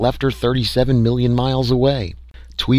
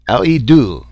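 put-on louder, higher French-accented question; 4 semitones higher, 6 dB louder than usual. A narrator's reading. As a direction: ask like a puzzled Frenchman. Frenchman asks in broken English.

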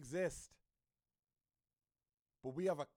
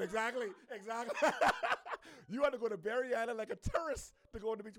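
loud, high burst of laughter; words breaking into a loud laugh; 4 semitones higher, 5 dB louder than usual. Real, not acted. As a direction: crack up while agreeing, loudly. Cracks up at the answer given.

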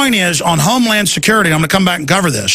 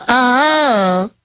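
fake Georgia accent; 6 semitones higher than usual. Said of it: "affected fake Georgia accent".

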